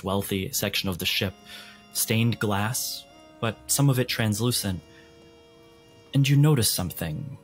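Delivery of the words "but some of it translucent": normal talking voice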